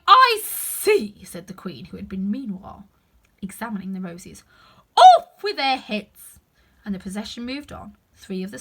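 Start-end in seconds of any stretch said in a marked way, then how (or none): 0.1-1.2 high, loud, imperious Queen; haughty Queen. Acted. The Queen sneering at the kneeling gardener, loud and high. 5.0-6.4 slow, booming royal command, much louder; furious Queen. Acted. The Queen ordering the gardeners beheaded, bellowed slowly.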